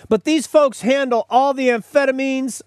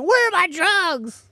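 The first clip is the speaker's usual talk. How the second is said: mock whiny caller, pitched up high; 9 semitones higher than usual. Host mimics a customer asking after drugs, whiny and high. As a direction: play a needy customer calling about drugs.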